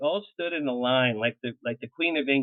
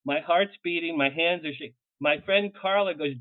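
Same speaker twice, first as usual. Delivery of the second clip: ordinary talk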